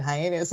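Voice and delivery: three-syllable joke version of heinous, "hyenas"; habitual mispronunciation. Retold or imitated. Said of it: "I said hyenas".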